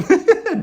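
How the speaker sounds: loud high cackle; loud high laugh. Real, not acted. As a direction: burst out laughing. Laughing at nickname.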